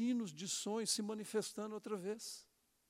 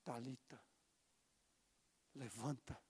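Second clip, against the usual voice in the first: tender command; 8 semitones lower, 15 dB quieter than usual. Acted. Jesus raising the girl.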